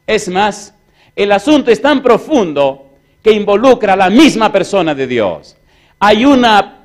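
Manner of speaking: loud, high-pitched pulpit preaching; forceful preaching. Acted. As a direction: drive it home to the congregation.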